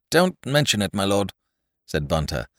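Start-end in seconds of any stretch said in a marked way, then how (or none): none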